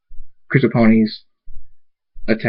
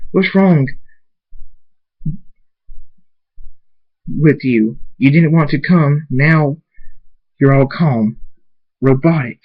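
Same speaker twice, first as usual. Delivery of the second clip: upset; 4 semitones higher than usual. Acted. Angry accusation between characters.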